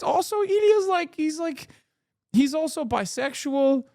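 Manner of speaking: very high, strained-up pitch; worked-up complaint. Real, not acted. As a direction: push the grievance way up high. Indignant complaint about show character.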